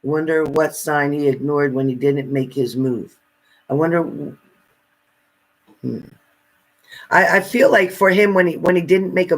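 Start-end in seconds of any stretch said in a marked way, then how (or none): none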